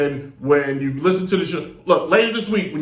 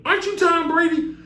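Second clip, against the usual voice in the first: surprised; 14 semitones higher than usual. Retold or imitated. Acting out meeting Brady in store.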